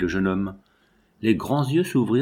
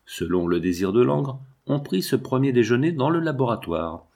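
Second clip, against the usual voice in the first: ordinary delivery, nothing marked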